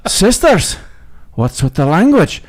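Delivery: high, aghast outcry easing down; scandalized reproach. Acted. Monks to the sisters who just swore, scandalized reproach. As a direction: call them out in mock shock. Shocked monks scold the nuns.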